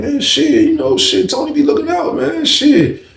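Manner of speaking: lightly voiced high whiny dude; an inmate excusing himself for hanging with Tony, in a high voice; other inmate. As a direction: pitch it up, pleading and defensive. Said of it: "He was like".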